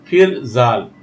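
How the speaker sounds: ordinary delivery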